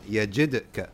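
Yajidka with the d clearly released; qalqala on d. Shown as a demonstration. Teacher models the required pronunciation, echoing d. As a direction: bounce the d in Yajidka before ka. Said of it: "You have to pronounce it".

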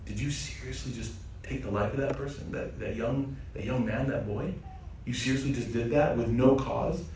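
soft, stunned questioning; a quiet, horrified whisper-like protest; hushed disbelief. Acted. Musa stunned by the killing.

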